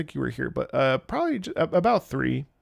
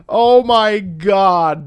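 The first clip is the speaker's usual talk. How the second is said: loud, drawn-out raised exclamation; real amazement; 5 semitones higher, 9 dB louder, much slower than usual. Real, not acted. Streamer reacting to audience figures, drawn-out amazement. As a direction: stretch it out slowly and loud.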